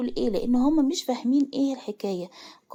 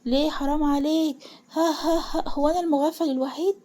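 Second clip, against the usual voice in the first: higher-pitched, acted hysterical protest; hysterical outburst; 5 semitones higher than usual. A narrator's reading. Son reproaching the father who hid the truth, hysterical. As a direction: break into ha-ha-ha amid reproach. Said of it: "وقعد يضحك ضحك هستيري".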